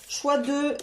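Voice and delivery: usual speaking voice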